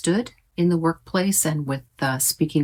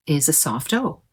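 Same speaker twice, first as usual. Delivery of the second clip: ordinary talk, nothing marked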